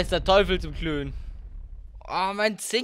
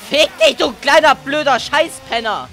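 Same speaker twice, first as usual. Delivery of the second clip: very loud, high screamed curse; real gamer fury; furious insult; 7 semitones higher, 8 dB louder than usual. Real, not acted. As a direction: scream the insult in full rage. Raging at enemy.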